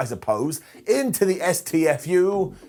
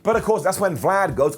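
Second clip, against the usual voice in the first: ordinary talk, nothing marked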